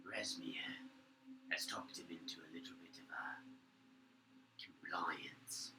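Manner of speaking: hushed, conspiratorial creature voice; whispering conspirator. Acted. Guards plotting against their masters in whispers. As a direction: plot in whispers so no one hears.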